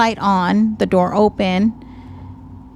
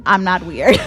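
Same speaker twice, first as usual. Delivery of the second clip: laughing denial; 4 dB louder than usual. Real, not acted.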